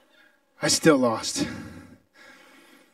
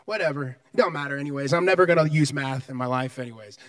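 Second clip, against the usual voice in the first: mock lazy student; 7 semitones lower, 3 dB quieter than usual. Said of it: "you were just like".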